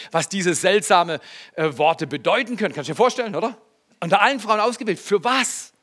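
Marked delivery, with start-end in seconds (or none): none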